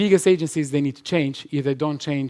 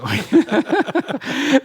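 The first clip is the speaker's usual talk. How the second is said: high, loud outburst of laughter; a sudden real laugh; laughing out loud at the question; burst of laughter; 12 semitones higher, 7 dB louder than usual. Real, not acted.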